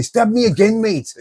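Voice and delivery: high-pitched, badly faked Glaswegian hard man; mock Glaswegian. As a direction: imitate a Glasgow lifer, voice shooting up. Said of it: "he'd probably say".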